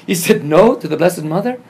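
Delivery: loud, high incredulous question; scandalized, amused outburst; amazed disbelief. Real, not acted. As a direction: exclaim it as if it's unthinkable. Amazed at brother refusing Mary.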